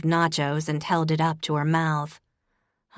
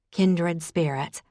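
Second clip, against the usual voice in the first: ordinary delivery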